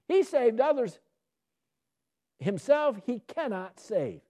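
high, slow, jeering; mocking priests. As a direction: sneer it at the man on the cross.

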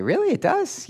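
very high-pitched surprised question; incredulous. Acted. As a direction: play a listener who can hardly believe it. Acts out listener's surprised doubt.

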